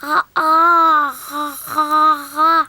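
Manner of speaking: talking with mouth wide open; mouth propped open. Acted. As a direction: try to speak with jaw held open. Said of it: "C'est très difficile de parler avec la bouche grande ouverte".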